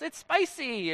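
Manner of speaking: mock-complain like a three-year-old son; imitating a toddler. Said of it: "and he goes".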